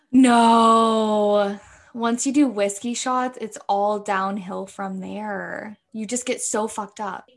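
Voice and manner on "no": shocked gasp. Real, not acted. Gasp at the whiskey news.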